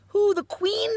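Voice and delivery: shrill high shocked cry; aghast. A narrator's reading. Sophie horrified that the giant wants to eat the Queen, aghast. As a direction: gasp out the question, very high and loud. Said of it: "Sophie cried aghast".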